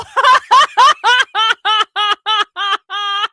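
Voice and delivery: loud, high burst of laughter; long rolling cackle; big laugh. Real, not acted.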